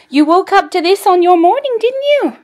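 high, loud cooing at the puppy. Real, not acted. Owner cooing at her dog in a high baby voice.

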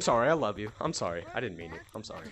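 suddenly low, hushed backpedal; meek apology. Real, not acted. Apologizing right after insulting someone, meek and low. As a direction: shrink from yelling to a soft sorry.

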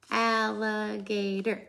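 very slow, each syllable apart. Shown as a demonstration. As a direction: clap out al-li-ga-tor slowly. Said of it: "count how many syllables".

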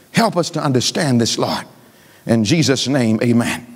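low, pleading public prayer; earnest prayer. Real, not acted. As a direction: drop the pitch and pray earnestly. Breaks off preaching to pray.

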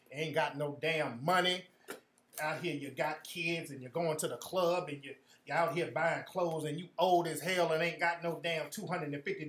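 low, soft, grumbling grandma lecture; scolding grandma. Retold or imitated. Grandma scolding her grown grandchild for asking for money, low and grumbling. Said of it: "she gonna give you some life lessons".

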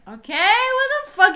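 high, loud exclamation of disbelief; indignant outburst. Real, not acted. Skipped by a stranger mid-question, indignant outburst. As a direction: blurt it out, pitch leaping up.

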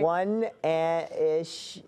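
long, stretched-out words; slow, uncertain guessing; drawn-out guess. Pretend. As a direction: hold each word while searching for the answer. Guessing a whispered phrase.

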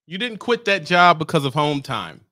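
usual speaking voice

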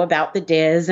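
ordinary talk, nothing marked

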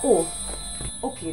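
ordinary delivery

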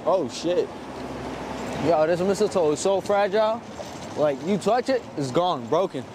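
ordinary talk

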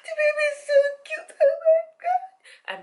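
loud, very high, hammed-up tearful wail; mock crying. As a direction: gush through pretend sobs, overcome by cuteness. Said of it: "I'm very emotional".

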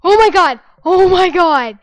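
loud, high oh my god; shocked shout. Real, not acted. Player escaping, loud shocked cries. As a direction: blurt out stunned shock.

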